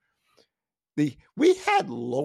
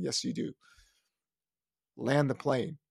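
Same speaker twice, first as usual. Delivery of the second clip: ordinary talk, nothing marked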